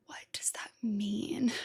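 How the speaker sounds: whispered, slow puzzled question. Real, not acted. To herself after reading the lyric: slow whispered question. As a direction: whisper it to yourself, baffled.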